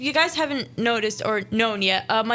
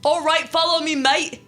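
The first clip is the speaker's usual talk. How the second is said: put-on weird British accent; 6 semitones higher, 4 dB louder than usual. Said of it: "Is that supposed to be British?".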